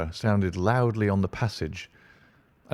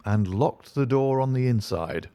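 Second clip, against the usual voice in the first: ordinary delivery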